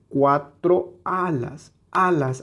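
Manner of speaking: "cuatro alas", slow, with "alas" set apart and stressed; prosodic stress. Shown as a demonstration. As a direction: lean on the first A of "alas". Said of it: "Aquí es como un acento prosódico". ("cuatro alas" lento, con acento en la primera A de "alas")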